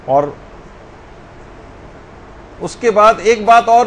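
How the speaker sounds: ordinary talk, nothing marked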